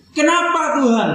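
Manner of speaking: high, slow, pleading cry; anguished plea. Acted. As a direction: beg God for healing, voice strained high. Despairing cry to God. (rintihan memohon, tinggi dan lambat)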